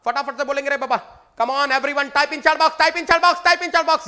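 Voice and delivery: high, loud, insistent call; loud urging. Real, not acted. Teacher loudly urging students to type in the chat. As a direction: drive everyone to type now.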